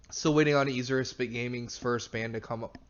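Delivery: normal talking voice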